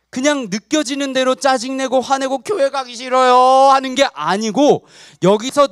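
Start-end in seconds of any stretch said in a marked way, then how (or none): 2.4-3.7 put-on drawn-out childish complaint; mock whining. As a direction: whine it slowly, stretching the last syllable. Acted child refusing to go. (칭얼거리는 아이 흉내)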